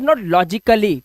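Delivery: logically as "lo-ji-ka-lee"; wrong pronunciation. Shown as a demonstration. Teacher mimics new students' logically as "logi-ka-lee", mispronounced. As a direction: sound a full "ka" inside logically. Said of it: "इसको लॉजिकली ही बोलते हैं".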